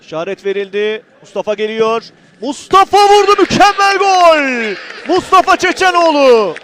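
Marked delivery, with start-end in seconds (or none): 2.4-6.5 screaming football commentator. Real, not acted. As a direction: drag out the goal call. Free kick goal called live.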